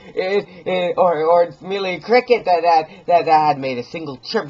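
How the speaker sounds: a hint of a frightened old man; old man reassuring himself. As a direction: talk yourself out of fear. Old man's comforting self-talk.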